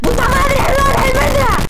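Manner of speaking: loud, high burst of relief; relieved shout. Real, not acted. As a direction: yell it out in disbelieving relief. Relief that Sim is alive.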